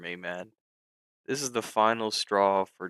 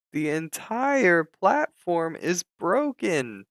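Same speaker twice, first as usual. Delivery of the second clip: higher and slow, stretched in disbelief; exasperated outburst; 7 semitones higher, 4 dB louder, slower than usual. Real, not acted. Trader raging that his platform is broken, slow exasperated cry. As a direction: drag it out like you can't believe it. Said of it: "I'm getting pissed off now".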